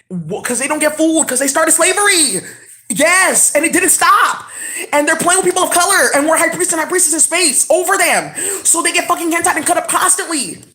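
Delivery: loud, high-pitched ranting; angry shouting. Real, not acted. Ranting about slavery that didn't stop, shouting angrily. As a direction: shout it out in an outraged tirade.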